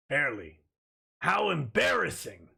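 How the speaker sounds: lightly voiced Vegeta, mock scouter reading; a hint of Vegeta sneering at a power level. Host reading a chat joke in a light Vegeta voice. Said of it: "Now in Vegeta's voice".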